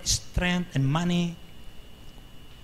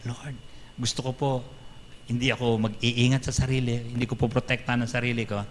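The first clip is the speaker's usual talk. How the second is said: softer, earnest prayer to the Lord; 5 semitones lower, 4 dB quieter than usual. Acted. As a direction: pray it humbly, voice dropped.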